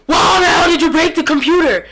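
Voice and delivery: sudden high, very loud scream; furious teacher shout. Retold or imitated. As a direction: explode at a student in a mock teacher's outburst. Teacher's sudden outburst, acted.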